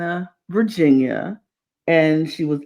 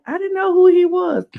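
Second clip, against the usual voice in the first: sister's voice; 13 semitones higher than usual. Retold or imitated. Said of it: "And she was like".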